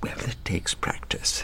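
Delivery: soft hushed whisper. Real, not acted. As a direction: whisper it aside. Whispered, unvoiced, -10 dB.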